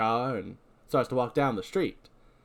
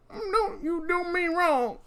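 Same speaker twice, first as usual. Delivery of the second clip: put-on high, slow, quavering old-timer; old prospector; much slower than usual. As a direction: whine it like a rattled old codger. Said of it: "It just really works for this voice".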